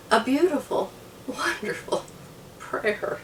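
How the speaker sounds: breathless whisper of emotion; almost voiceless, overcome with feeling; choked-up whisper. Real, not acted. Moved, describing husband's prayer.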